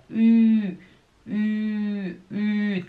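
long, held Ü through tightly rounded lips; pouted Ü. Shown as a demonstration. Teacher isolating the vowel for learners, pouted and held. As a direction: sustain "Ü" with a pout, each one separate. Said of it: "tem que fazer esse biquinho".

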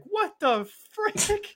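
outraged at the ending of a Disney film; shocked outcry. Retold or imitated. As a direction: stretch it out in stunned disbelief. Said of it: "we were like".

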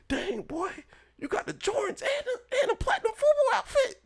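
squealing falsetto astonishment; imitated amazed schoolmates. Classmates seeing both new gifts, amazed. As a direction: mimic impressed kids in a very high squeal.

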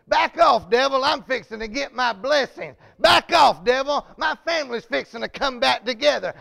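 loud, rising defiance against the devil; defiant command. Shown as a demonstration. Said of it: "that's the time you need to say".

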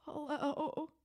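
high, soft put-on mom voice; imitating mom's hello. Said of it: "I called her, and she sounded".

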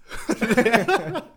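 high loud laugh breaking out; real helpless laugh; burst of laughter. Real, not acted. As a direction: laugh out at your own story. Laughing at own story.

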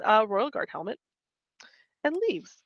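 ordinary talk, nothing marked

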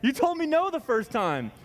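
high, indignant mock rebuttal; teasing a friend who rewrites history; playful protest.

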